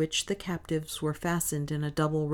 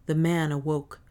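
ordinary delivery

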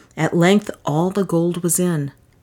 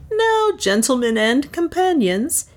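high-pitched hearty address; swaggering toast; 10 semitones higher than usual. Acted. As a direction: hail the whole company grandly. Leonard toasts the slavers.

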